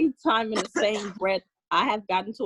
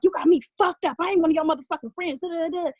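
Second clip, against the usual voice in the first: mother's yell; 7 semitones higher, 3 dB louder than usual. Retold or imitated. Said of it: "she's like".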